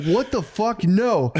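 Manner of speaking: shocked disbelief. Retold or imitated. Cortez horrified again.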